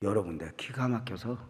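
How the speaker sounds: ordinary talk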